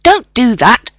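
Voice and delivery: a quick angry bark at Rodney; sharp snap. A narrator's reading. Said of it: "John snapped".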